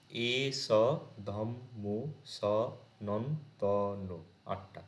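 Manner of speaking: very slow, one syllable at a time with pauses; counting syllables. Shown as a demonstration. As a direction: tap out each syllable separately. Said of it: "আবার লাস্টটা যদি আমরা দেখি". (ধীরে ধীরে প্রতিটি syllable আলাদা করে গোনা)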